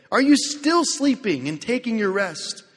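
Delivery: Jesus scolding the sleeping disciples, loud; rebuke. Acted. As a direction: wake them with a sharp rebuke.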